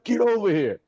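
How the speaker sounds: high mock coach holler. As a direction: imitate a coach calling a player over. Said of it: "He's like".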